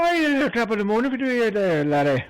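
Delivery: a playful mock Irish toast, laddie and all; Irish accent. Toasting his friend over a drink in a mock Irish brogue.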